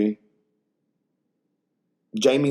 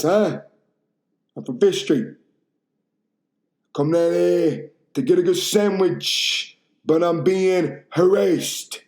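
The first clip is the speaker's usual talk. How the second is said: hammed-up Jersey mobster griping about a beggar; 9 semitones higher than usual. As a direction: play a pushy Newark gangster complaining. Character voice of Newark mobster.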